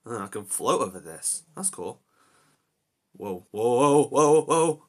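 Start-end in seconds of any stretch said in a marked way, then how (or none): 3.2-4.8 loud rising astonished whoas; excited whoas. Real, not acted. Gamer floating with anti-gravity, loud amazed whoas. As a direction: ride the float with wonder.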